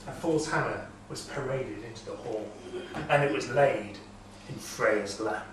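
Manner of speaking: plain reading voice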